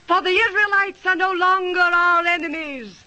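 ordinary talk

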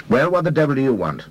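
put-on an irritated young man demanding. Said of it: "demanded Mister Carlin".

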